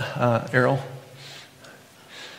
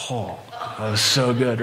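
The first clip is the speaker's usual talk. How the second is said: slow, relishing sigh of pleasure; savoring. Retold or imitated. Preacher relishing the sugary milk of childhood, slowly. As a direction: taste the memory as you say it.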